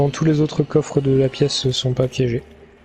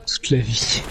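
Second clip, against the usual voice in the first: whispering; 3 semitones lower, slower than usual. Retold or imitated. Mocking teammate's slip.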